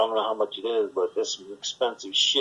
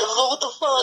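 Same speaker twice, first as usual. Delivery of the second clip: startled yelp; 13 semitones higher than usual. Retold or imitated. Walking into a cobweb.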